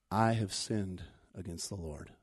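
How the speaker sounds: low, soft admission of sin; subdued confession. Acted. David confessing to Nathan the prophet, subdued and low. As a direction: confess quietly, voice dropped.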